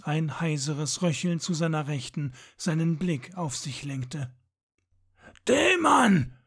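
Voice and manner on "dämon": much higher and louder, a sharp call; cried out. A narrator's reading. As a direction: call out to his fallen companion. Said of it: "rief er aus". (lauter, hoher Ausruf)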